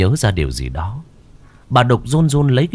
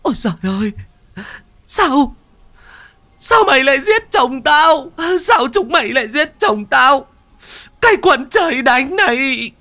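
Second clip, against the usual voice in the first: high, loud anguished shriek; 4 dB louder than usual. A narrator's reading. Widow shrieking at the demons who killed her husband, high and loud. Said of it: "Bà rú lên".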